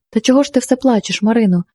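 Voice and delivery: plain reading voice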